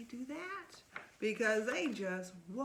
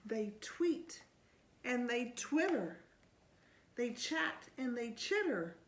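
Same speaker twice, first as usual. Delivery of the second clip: put-on lively read-aloud to a child; storybook reading; 2 semitones lower, 4 dB louder than usual. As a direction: read it like bedtime story time. Reading picture book aloud.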